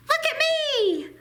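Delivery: put-on a little girl shouting to be seen; excited little girl calling. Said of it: "the girl called".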